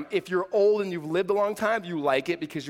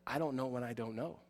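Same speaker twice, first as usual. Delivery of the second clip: soft admission; 5 semitones lower, 12 dB quieter than usual. Pretend. Sitting with a suffering person.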